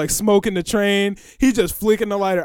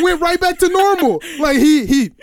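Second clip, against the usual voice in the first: ordinary talk, nothing marked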